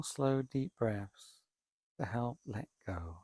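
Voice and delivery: normal talking voice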